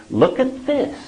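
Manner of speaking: high eager elf voice; excited elf. Retold or imitated. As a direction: show it off, thrilled. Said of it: "very excited".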